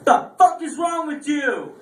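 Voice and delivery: slow, high exasperated outburst; incredulous outburst. Retold or imitated. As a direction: stare him down and let it rip. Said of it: "I looked at him just like this".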